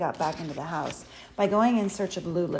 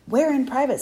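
high, loud, agitated retort; frantic challenge; wild-eyed; 6 semitones higher, 8 dB louder than usual. A narrator's reading. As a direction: throw it back in alarm. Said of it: "wild-eyed".

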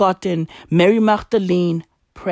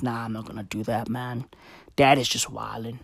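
low, much softer, fast mumble; laid-back Jesus; 11 semitones lower, 8 dB quieter than usual. Acted. Jesus shrugging off his dad's orders, low, soft and fast. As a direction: toss it off like a lazy mate.

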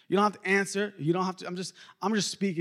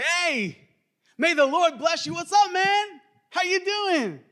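hammed-up hearty hello, pitched way up; cheerful greeting; 11 semitones higher, 4 dB louder than usual. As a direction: greet an old buddy with high, over-the-top cheer. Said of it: "I'm gonna paint a little picture".

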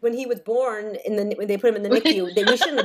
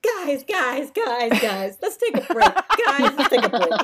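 put-on pleading child; 4 semitones higher, 4 dB louder, slower than usual. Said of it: "the one funny thing he said".

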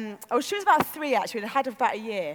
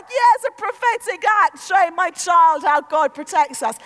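loud, raised proud-mum yell; shouted explanation; 3 semitones higher, 8 dB louder than usual. Retold or imitated. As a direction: call it out over the wind. Mum yelling explanation in storm.

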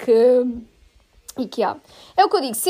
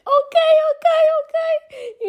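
high, teary, shaking ok; sobbing, trembling yes to the nurse; crying ok; 3 dB louder, much slower than usual. Retold or imitated. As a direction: squeeze it out while crying. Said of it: "a chorar tanto".

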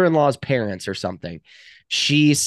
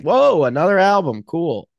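bright, high "whoa, cool"; mock excitement; 6 semitones higher, 7 dB louder than usual. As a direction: fake thrilled reaction. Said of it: "like, was like".

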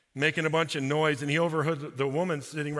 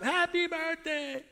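surprise shout; 12 semitones higher, slower than usual. Retold or imitated. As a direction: yell it as they jump out. Crowd jumps out at surprise party.

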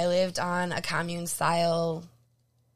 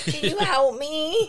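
high, slow, pleading little voice; whiny pleading voice; 9 semitones higher, 4 dB louder, slower than usual. Acted. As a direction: beg for help in a put-on baby voice. Asking for help, shirt stuck.

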